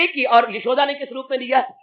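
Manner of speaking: usual speaking voice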